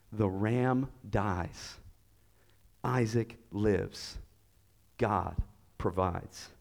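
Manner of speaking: very slow and soft, pausing between phrases; slow solemn statement. Real, not acted. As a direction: let each short sentence sink in. Solemn climax of the sermon.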